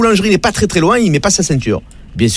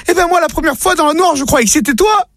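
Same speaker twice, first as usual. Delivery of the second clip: wife's voice pushed up high, breathless with shock; high panicked wife; 12 semitones higher than usual. Acted. Wife tells husband of intruder, high shocked voice. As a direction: squeal it out like a horrified wife.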